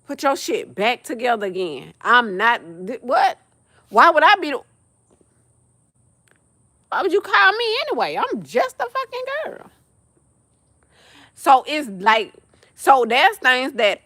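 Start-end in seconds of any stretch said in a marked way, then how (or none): 2.9-9.7 sudden high pitched what; shocked disbelief. Real, not acted. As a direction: squeak out the disbelief. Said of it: "outraged disbelief".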